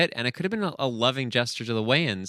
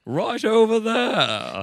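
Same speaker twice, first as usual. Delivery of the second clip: put-on high, slow grandiose Gothic English accent; 12 semitones higher, 5 dB louder, much slower than usual. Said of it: "sort of grandiose Gothic English accent".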